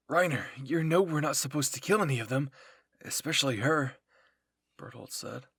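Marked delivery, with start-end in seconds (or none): 0.1-4.0 loud, urgent objection; agitated protest. A narrator's reading. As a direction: protest loudly to a comrade. Comrade objects to the violence.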